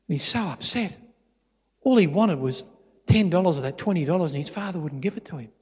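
ordinary talk

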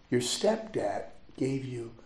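slow, soft near-whisper; retold revelation in a slow hush. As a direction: let the secret out slowly, barely voiced. Retelling a stunning family revelation.

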